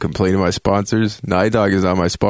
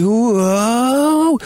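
very high delighted whoa; high thrilled exclamation; awed whoa; 3 dB louder than usual. Retold or imitated. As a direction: ride the swing in wonder. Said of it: "and you're like".

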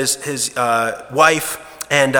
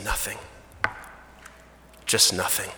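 very soft, withdrawn wife; hollow, much softer denial; broken whisper; 6 semitones lower, 11 dB quieter than usual. Acted. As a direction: barely push the word out. Wife shoves husband away crying.

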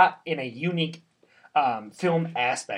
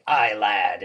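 put-on old sea dog; 5 semitones lower than usual. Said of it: "He speaks like he, this old sea dog".

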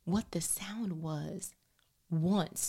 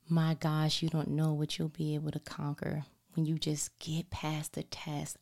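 ordinary delivery

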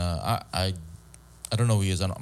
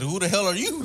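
sassy comeback; 13 semitones higher than usual. Pretend. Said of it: "You should have said".

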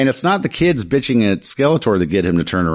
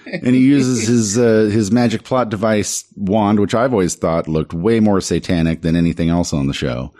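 ordinary delivery

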